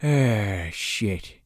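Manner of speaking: deflated, low and trailing away; resigned sigh. Retold or imitated. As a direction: imitate a defeated sigh, letting the voice drop. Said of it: "And he just sighs".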